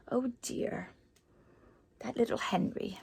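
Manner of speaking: a barely voiced, sympathetic oh dear; soft sad sigh. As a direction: sigh it quietly for the lonely man. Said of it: "sad aside to listeners".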